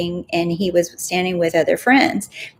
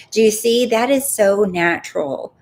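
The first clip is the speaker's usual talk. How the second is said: ordinary talk, nothing marked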